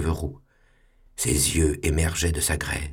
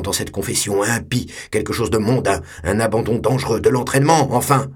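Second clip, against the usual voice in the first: reproachful superior; 4 semitones higher, 4 dB louder than usual. Acted. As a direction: lecture the boy with rising indignation. Superior lectures the pupil.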